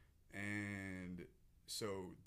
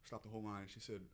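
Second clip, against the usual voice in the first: ordinary talk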